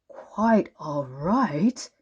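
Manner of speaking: hushed, slow, to himself; wondering repeat to himself. A narrator's reading. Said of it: "repeated Mister Good to himself".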